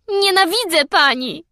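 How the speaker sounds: high shout, choking with rage; choking scream. A narrator's reading. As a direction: scream it through a tight throat. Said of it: "krzyknęła, krztusząc się".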